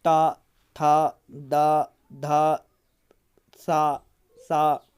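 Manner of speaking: ता, था, दा, धा said very slowly and distinctly; drawn-out syllables. Shown as a demonstration. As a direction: stretch each dental sound out. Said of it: "लृतु लसानाम दंता".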